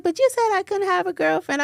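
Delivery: high-pitched retold kid's protest; lightly voiced child. Son protests to his mom about the girlfriend rule, lightly voiced child.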